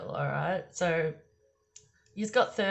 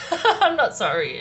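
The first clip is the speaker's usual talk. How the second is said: laughing; 7 semitones higher, 11 dB louder than usual. Shown as a demonstration.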